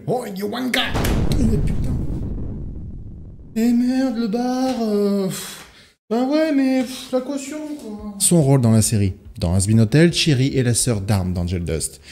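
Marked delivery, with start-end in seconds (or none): 0.1-8.1 high-pitched Aussie tradesman voice; Australian accent, way up high. Retold or imitated. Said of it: "lui donner un petit accent australien".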